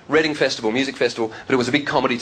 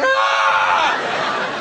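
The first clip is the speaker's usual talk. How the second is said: crowd gasp. Retold or imitated. Whole room gasping.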